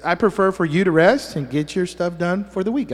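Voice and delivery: usual speaking voice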